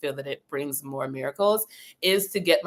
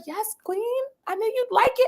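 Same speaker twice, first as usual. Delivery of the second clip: God voice playful. Pretend. God answering her.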